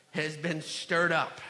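ordinary talk

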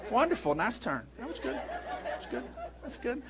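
soft, quiet reassurance after the panic; mock soothing praise. Son imitating dad calming down after the turn, soft praise. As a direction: settle back into gentle praise. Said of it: "impersonation of me".

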